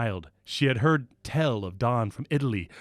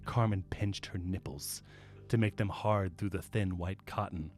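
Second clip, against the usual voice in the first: quiet; 5 semitones lower, 9 dB quieter than usual. Shown as a demonstration. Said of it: "I'm gonna read it again".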